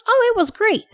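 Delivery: put-on high, bright child voice for the son; son's cheerful reply. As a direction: mimic her son's upbeat answer, pitched high. Son quoted by mother.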